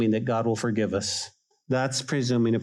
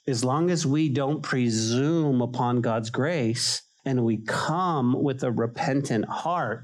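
ordinary talk

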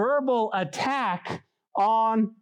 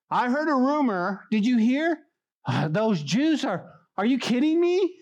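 incredulous Sanballat; 6 semitones higher than usual. Acted. Acting out incensed Sanballat hearing rumors.

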